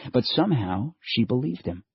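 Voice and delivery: usual speaking voice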